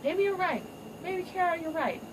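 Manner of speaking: high, gentle mock concession; high lightly hammed king relenting; softened Simba. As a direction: give in sweetly to your daughter. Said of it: "Simba's like".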